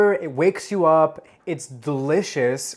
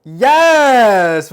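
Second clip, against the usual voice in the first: triumphant yes; 8 semitones higher, 13 dB louder than usual. Real, not acted. Cocktail shaker finally opens.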